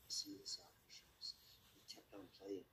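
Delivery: breathy storyteller's whisper, dropped low; hushed whisper. A narrator's reading. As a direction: whisper it like a secret glance. Sneaking a glance at shoes.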